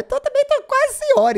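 mock-offended falsetto; comic high-pitched protest; squeaky joke. Real, not acted. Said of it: "self-mocking joke".

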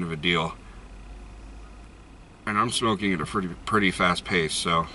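talking around pipe stem; pipe in mouth. Real, not acted. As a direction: lips clenched on pipe. Seen 2: Pipe stem held between lips through most of the labelled speech.

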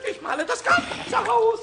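slow, drawn-out defiant yell; defiant shout. Acted. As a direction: hurl it back in stubborn triumph. Pumuckl defies Eder.

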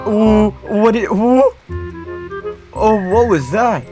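high, loud startled yelps; panicking gamer. Real, not acted. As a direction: caught off guard mid-match. Sudden shock during gameplay.

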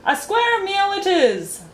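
high, loud, drawn-out proclamation; shouting. A narrator's reading. Humbug announcing the next meal to the waiters, shouting. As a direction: shout it out grandly. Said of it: "shouted the humbug again".

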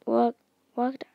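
usual speaking voice

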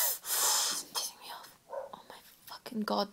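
whispered cursing; annoyed muttering. Real, not acted. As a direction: seethe under your breath in a whisper. Muttering about neighbour's dog.

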